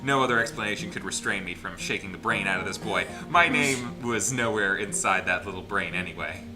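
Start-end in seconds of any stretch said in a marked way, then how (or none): none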